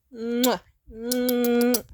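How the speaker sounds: loud smacking air kisses; loud playful goodbye air kisses; kiss sounds. Real, not acted. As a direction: blow two big kisses to the viewer. Signing off with kisses.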